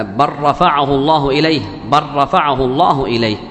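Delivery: بل رفعه run together as "barrafaʿahu", no L; idgham merging. Shown as a demonstration. Teacher giving a second verse example, بل رفعه fused with doubled R. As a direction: merge the lām of بل into the rā of رفعه. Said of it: "فإنه يدغم اللام عند الراء".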